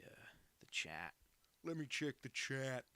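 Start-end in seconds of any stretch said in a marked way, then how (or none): 1.6-2.9 attempted Australian accent, veering Kiwi; Aussie accent. Streamer repeating himself to viewers, in a put-on Aussie accent. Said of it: "your Aussie isn't too bad".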